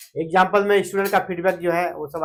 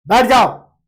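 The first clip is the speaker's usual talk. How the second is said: बैठ जाओ barked louder; angry order; 6 dB louder, slower than usual. Shown as a demonstration. Teacher angrily orders someone to sit, louder. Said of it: "गुस्सा के किसी चीज को बोल रहे हैं".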